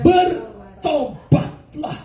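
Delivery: slow, high preacher's cry, syllable by syllable; loud proclamation. Acted. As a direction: cry out the call, high and drawn out. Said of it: "dia dengan suara keras".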